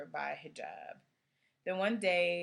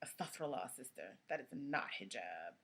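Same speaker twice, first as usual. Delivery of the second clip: low, soft scolding voice; hijabi police; 4 semitones lower, 10 dB quieter than usual. Acted. Hijabi police pouncing on a sister over her scarf, low disapproving voice. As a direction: put on a disapproving busybody, pitched way down. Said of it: "the hijabi police would pounce".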